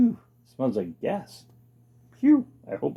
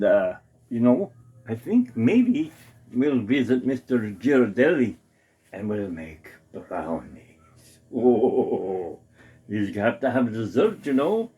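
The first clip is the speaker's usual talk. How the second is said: usual speaking voice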